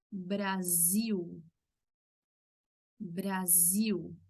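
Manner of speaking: Brasil said braziw, voiced z and final l as w; Portuguese Brasil. Shown as a demonstration. Teacher modelling Brazilian pronunciation of Brasil. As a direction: say Brasil with a buzzing z. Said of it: "Sonido de Z".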